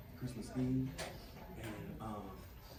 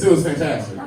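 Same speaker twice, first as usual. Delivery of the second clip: normal talking voice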